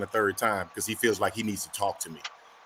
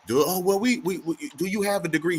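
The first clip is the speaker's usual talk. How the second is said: mock flustered manager; 6 semitones higher than usual. Mocking the stammering manager.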